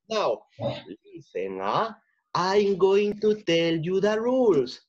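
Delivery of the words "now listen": normal talking voice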